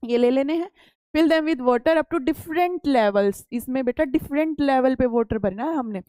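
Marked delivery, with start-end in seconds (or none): 1.1-3.4 reads each English word out slowly and carefully; slow textbook reading. Acted. Teacher reading the textbook aloud to pupils, slow and deliberate. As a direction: spell out the book line for the class.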